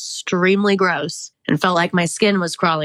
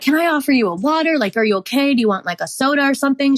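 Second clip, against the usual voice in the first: mock-fussing, much higher and quick; overly concerned receptionist; 8 semitones higher, faster than usual. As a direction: imitate a fretting helper rushing out offers. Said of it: "she's like".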